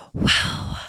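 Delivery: breathy whispered wow; mock ASMR. Vlogger opening designer heels for viewers, mock ASMR whisper. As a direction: whisper it with no voice at all. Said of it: "I could be doing A S M R".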